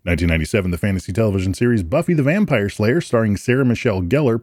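ordinary delivery

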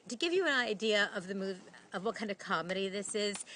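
usual speaking voice

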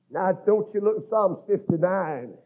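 normal talking voice

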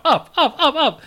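lightly imitating a toddler demanding to be carried. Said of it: "run over you and say".

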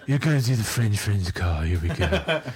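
low monotone, weary bass voice; Kif Kroker. Retold or imitated. Reluctant host starting the French conference call, monotone bass imitation. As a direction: sigh it out in a mock deep, tipsy drone. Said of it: "it's almost like Kif Kroker from Futurama".

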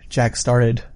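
plain reading voice

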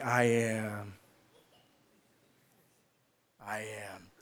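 low, drawn-out words left hanging; puzzled Moses, low and lingering; hesitant, unfinished sentence. Acted. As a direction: trail off softly, waiting for the rest. Moses puzzled, waiting for the rest.

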